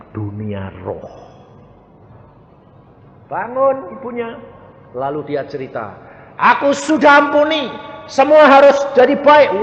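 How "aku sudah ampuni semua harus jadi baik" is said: higher, loud proclamation from the mother; retold mother preaching. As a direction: declare it like the awakened mother. (ibu berkhotbah kepada anak cucu dengan suara lantang)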